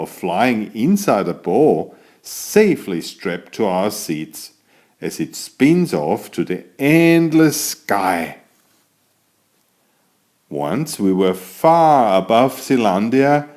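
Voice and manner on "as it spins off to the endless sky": ordinary delivery, nothing marked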